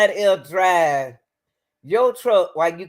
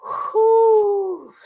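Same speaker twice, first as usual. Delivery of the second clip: ordinary talk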